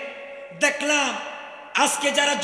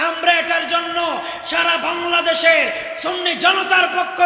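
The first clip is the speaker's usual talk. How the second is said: high, forceful sermon delivery at full voice; impassioned preaching; 4 semitones higher, 5 dB louder than usual. Real, not acted. Preacher condemning fighting in the mosque to his congregation, impassioned. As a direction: drive each phrase home with ringing, raised voice.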